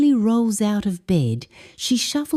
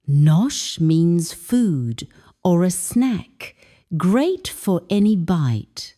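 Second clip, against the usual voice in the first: ordinary delivery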